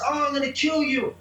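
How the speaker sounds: imitated yell at ordinary volume; mock scream.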